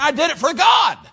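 high, loud declaration rising onto God; emphatic shout. Acted. Preacher paraphrases David answering his wife, emphatic shout. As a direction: proclaim it forcefully, building to a shout.

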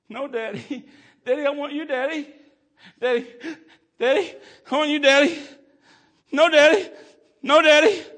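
put-on high, loud wailing child's plea; little girl wailing for her daddy across the gulf; crying child pleading. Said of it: "She'd start going".

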